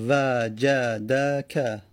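وجدک stretched very slowly, syllable by syllable; teacher drawing out وجدک; very slow demo. Shown as a demonstration. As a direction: spell out وجدک. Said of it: "فتحه داره".

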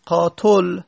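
قاتل said "qaatol", rounded o before the l; damma on the ta. Shown as a demonstration. Teacher showing the heavy alternative, damma on ta. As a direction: round the last vowel of قاتل to o. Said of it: "دمه بدیم بگیم قاتل".

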